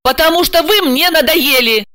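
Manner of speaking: high-pitched, exasperated outburst; fed up. A narrator's reading. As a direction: tell him off bluntly. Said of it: "Не стала скрытничать Ася". (раздражённо, в сердцах)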